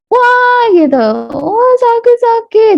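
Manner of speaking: high, hammed-up child's wail; child wailing in pain. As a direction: exaggerate a small hurt like a child. Child's big reaction to tiny scrape. (rengekan anak kesakitan yang dilebih-lebihkan)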